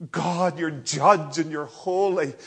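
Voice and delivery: loud, high, anguished appeal; pleading cry. Acted. Jesus crying out to God in a loud, high plea. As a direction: call up to God at full voice.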